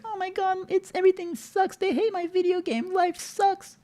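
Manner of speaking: high, whiny mock thirteen-year-old; whiny teenager. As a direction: whine like a sulky teen. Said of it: "it might be like".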